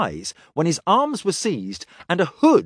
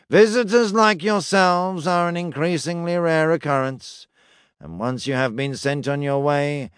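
flat monotone voice. Acted. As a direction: intone it without any feeling. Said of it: "intoned in a flat, vaguely nasal voice".

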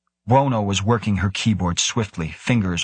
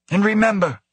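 high, sharp director's voice; sharp warning; 12 semitones higher than usual. A narrator's reading. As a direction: pitch it way up and warn them sharply. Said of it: "Wo said sharply".

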